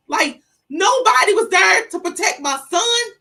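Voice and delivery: high, loud protest; anguished outburst. Real, not acted. Mother grieving that nobody protected her son, anguished outburst.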